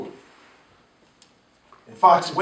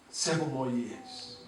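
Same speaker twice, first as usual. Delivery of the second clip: resigned; 4 semitones lower, 12 dB quieter, slower than usual. Retold or imitated. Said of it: "he now said".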